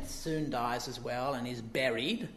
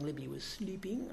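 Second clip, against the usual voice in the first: whisper; 8 dB quieter than usual. Real, not acted. Aside about sleeping daughter.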